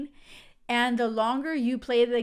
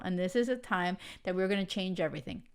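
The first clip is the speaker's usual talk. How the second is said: ordinary talk, nothing marked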